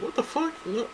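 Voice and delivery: a soft, high squeak of disbelief; incredulous. Retold or imitated. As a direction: jump way up in pitch in shock. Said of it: "I'm like".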